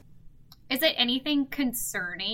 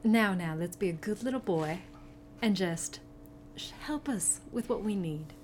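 put-on lower, softer, as to a small child; gentle coaxing; 4 semitones lower, 5 dB quieter than usual. Player coaxing the captive kobold to help, soothing. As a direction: soothe with little pauses, condescendingly sweet.

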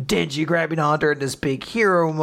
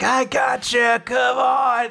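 lightly higher heroic call; eager hero; 7 semitones higher, slower than usual. Acted. Denji grabbing Asa mid-fall, eager and a bit higher. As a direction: reach out with a quick rescue. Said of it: "Denji comes in, is like".

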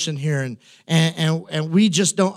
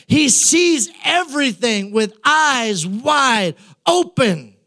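impassioned preaching; 6 semitones higher than usual. Real, not acted. Preacher building to a climax before the congregation, full force. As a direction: preach it with rising fire.

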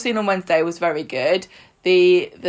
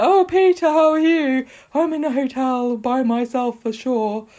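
high, put-on phone voice, easing down; hammed-up high-pitched impersonation; Carla impression; 5 semitones higher than usual. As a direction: badly pretend to be another woman on the phone. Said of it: "do an impression of you".

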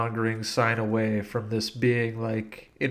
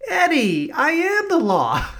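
high, slow cartoon rabbit voice; Roger Rabbit voice; 4 dB louder than usual. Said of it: "Roger Rabbit is Judge Dredd".

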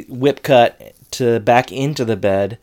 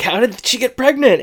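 shrill-free high squeak, much higher and louder; panicked Corey; 13 semitones higher, 4 dB louder than usual. Retold or imitated. Corey panicking that Topanga is pregnant, high panicked voice. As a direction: jump way up in pitch, panicking.

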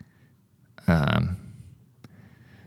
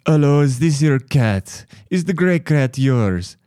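higher, lightly voiced polite lady; imitating the Russian lady; 7 semitones higher, 3 dB louder than usual. Russian neighbor asking about the cat, light imitation. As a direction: play her sweet question mockingly.

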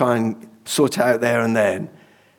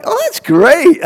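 delighted; 6 dB louder, slower than usual. Retold or imitated. Delighted reply to fellow Christians.

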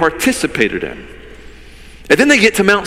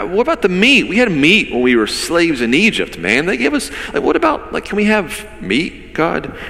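mock complaining; 3 semitones higher than usual. Hungry Israelites griping to God about meat, mock complaining. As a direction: imitate a petulant complaint, pitch climbing on the plea. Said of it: "They were found constantly complaining".

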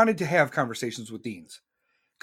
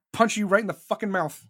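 ordinary talk

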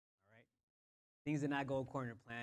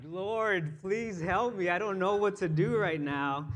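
loud, raised-pitch cry to God; desperate plea; 5 semitones higher, 8 dB louder, slower than usual. Retold or imitated. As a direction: plead loudly, as if at wit's end. Acted desperate prayer in retold mishap.

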